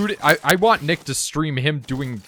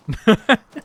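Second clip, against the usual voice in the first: loud laugh; 10 semitones higher, 9 dB louder than usual. Real, not acted. Reacts to friend never streaming.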